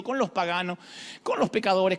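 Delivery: ordinary talk, nothing marked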